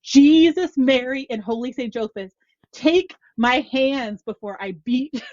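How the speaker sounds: loud, high mock outburst from a frantic mother; exasperated Irish mother. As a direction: belt it out like a fed-up mom calling on the saints. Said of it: "and she'd go".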